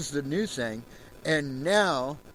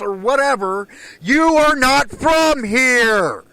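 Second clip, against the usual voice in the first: much higher and louder, yelling with real rage; furious shout; 9 semitones higher, 8 dB louder than usual. Real, not acted. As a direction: bellow it at them, fed up. Furious rant at racist viewers.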